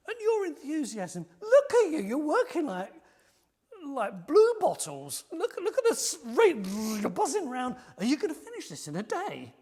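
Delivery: loud, high mocking jeer with a laugh; scornful laughing taunt. Enemies laughing at the builders' pace, loud scornful jeering. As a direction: pour scorn on their frantic work. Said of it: "pouring scorn and laughing at the whole thing".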